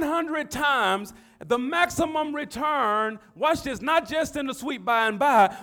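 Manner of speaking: loud, high-pitched preacher delivery; impassioned pulpit proclamation; full-force preaching. Real, not acted.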